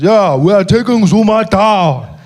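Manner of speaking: put-on slow, loud, heavy-accented; Malema impression. Malema greeting the comedian, slow and loud. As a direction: drag each word, teasing. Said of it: "He says".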